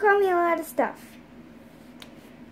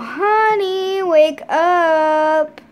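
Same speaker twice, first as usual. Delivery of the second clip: mom voice; 2 semitones higher, 8 dB louder, much slower than usual. Pretend. Mom wakes child for school.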